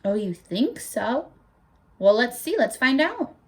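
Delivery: ordinary delivery, nothing marked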